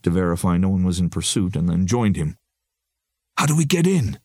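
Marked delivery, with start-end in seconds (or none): none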